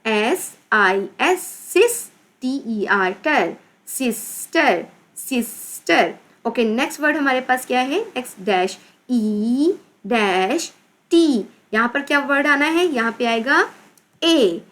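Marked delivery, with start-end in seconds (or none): 0.1-6.1 slow spelling, then drawn-out syllables; sounding out. Shown as a demonstration. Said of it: "कैसे read करेंगे इसको?". 8.4-11.3 slow, drawn-out letter names; spelling out. Shown as a demonstration. Teacher spelling with blanks.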